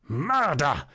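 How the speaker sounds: tight, half-held-back shriek; cry through teeth. A narrator's reading. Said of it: "mehr durch die Zähne als laut ausgestoßenen Schrei".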